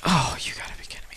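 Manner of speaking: quick, whispery exasperated aside; hushed mock disbelief. Gamer retelling his reaction to a near knockout, hushed disbelief. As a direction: mutter it fast under your breath.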